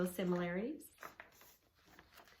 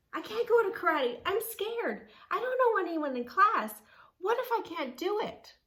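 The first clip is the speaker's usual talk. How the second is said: put-on high, loud, rushed scared girl's outburst; frightened flurry; 12 semitones higher, 9 dB louder than usual. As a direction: blurt the fears out fast on the edge of tears. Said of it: "A flurry of words came along with it".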